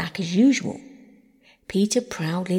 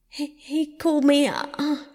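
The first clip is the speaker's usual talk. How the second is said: choked, higher voice through sobs; sobbing; 8 semitones higher, 3 dB louder than usual. A narrator's reading. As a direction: speak through tears, stammering. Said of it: "sobbed Phyllis".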